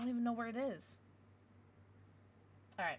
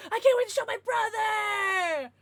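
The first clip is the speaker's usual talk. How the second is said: higher and louder eager cry; excited shout; 9 semitones higher, 9 dB louder than usual. Real, not acted. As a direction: shout it out, bursting to share. Excited about showing the card.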